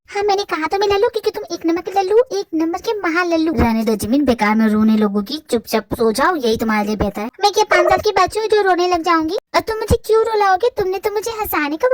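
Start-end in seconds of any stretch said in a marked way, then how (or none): 3.5-7.3 put-on boyfriend voice. Boyfriend telling girlfriend to just sleep, low teasing voice. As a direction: lower male voice, dismissive and teasing.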